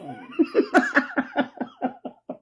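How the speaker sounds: long high-pitched breathy laugh; amused laughter trailing on. Real, not acted. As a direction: laugh at yourself in a high, airy run. Laughs at her imagined reply.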